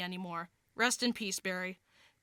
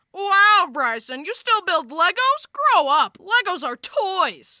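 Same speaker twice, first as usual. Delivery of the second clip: loud, higher-pitched jeering; scornful mockery; 6 semitones higher, 7 dB louder, slower than usual. Peer mocking Bryson for still building Legos, scornful. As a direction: taunt him, stretching the last words. Someone mocks him for Legos.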